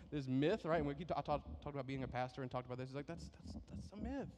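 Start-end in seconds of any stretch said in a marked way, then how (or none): none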